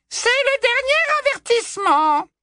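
high, sickly sweet menace; sugary cruel voice. Acted. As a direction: smile while you threaten. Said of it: "la voix doucereuse d'Ombrage". (voix doucereuse et cruelle)

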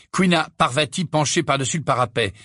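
ordinary delivery, nothing marked

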